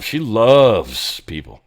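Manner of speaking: slow dry sarcastic drawl. Acted. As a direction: say it slowly with heavy sarcasm. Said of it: "I'm being sarcastic".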